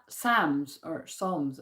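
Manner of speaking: slow "Psalms or Psalms", "sahmz" then "sawngz"; two variants. Shown as a demonstration. Vlogger weighing two pronunciations, slow. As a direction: contrast the two "Psalms", drawn out. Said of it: "kinda like".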